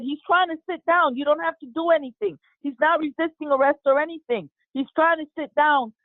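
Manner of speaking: high-pitched urgent protest, retold; insistent plea, pitched well up; retold pleading. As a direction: imitate a wife pleading with police. Wife pleads as police restrain husband.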